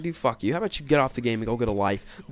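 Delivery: usual speaking voice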